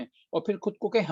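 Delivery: ordinary delivery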